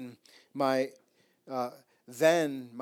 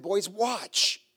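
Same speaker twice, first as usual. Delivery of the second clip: cocky boast; 5 semitones higher, 5 dB louder, much slower than usual. Retold or imitated. Showing off to the boys.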